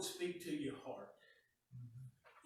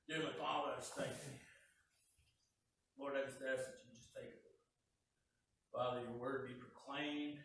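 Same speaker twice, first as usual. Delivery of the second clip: prayer; 4 semitones lower, 7 dB quieter, faster than usual. Acted. Preacher prays before sermon.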